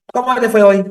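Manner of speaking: high, loud bright-voiced mother asking; mother's cheery question. Psychologist mimics mother greeting her son at lunch, high and loud. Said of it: "Así".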